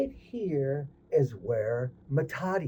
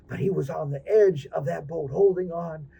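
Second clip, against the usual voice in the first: plain reading voice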